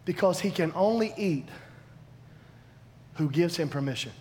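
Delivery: ordinary talk, nothing marked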